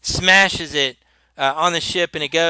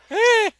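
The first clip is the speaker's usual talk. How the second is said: startled yelp. Acted. Gilligan reacting while running.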